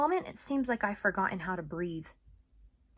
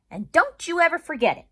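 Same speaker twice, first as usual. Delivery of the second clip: higher and louder, pleased; Bob triumphant; 10 semitones higher, 14 dB louder than usual. Acted. Bob satisfied with Ivan, triumphant. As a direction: crow it happily. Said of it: "he says".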